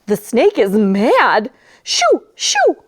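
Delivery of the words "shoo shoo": high frantic shooing; panicked dog shooing. Acted. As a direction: shoo the snake off in a squeak.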